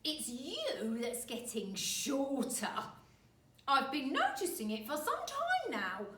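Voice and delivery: put-on slow, loud, gloating old man; horrible grin. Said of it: "grinning horribly".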